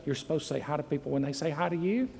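put-on quick, soft nudge to a child; hushed parental prompt. Parent nudging a shy child at church, hushed reminder. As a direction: parent prompting a kid under their breath, fast. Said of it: "we nudge them and say".

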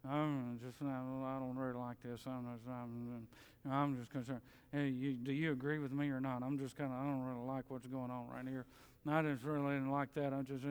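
low, soft muttering complaint; grumbler's undertones. Retold or imitated. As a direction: mutter your dissatisfaction under your breath. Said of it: "grumbling is undertones of dissatisfaction".

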